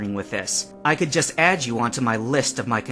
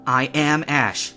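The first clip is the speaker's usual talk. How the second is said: louder, slow declaration; defiant insistence; 4 semitones higher, 6 dB louder, slower than usual. A narrator's reading. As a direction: plant every word.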